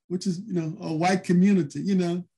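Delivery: ordinary talk, nothing marked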